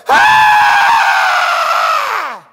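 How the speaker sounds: sudden high far-off scream of grief; anguished scream. Retold or imitated. Mother across the street finding her son dead, screaming. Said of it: "you hear something go".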